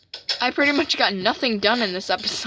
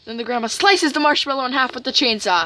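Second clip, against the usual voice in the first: ordinary delivery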